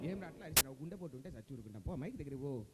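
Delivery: low, soft startled grumble; Jayaprakash Reddy impression. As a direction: scold under your breath. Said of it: "Jayaprakash Reddy గారు".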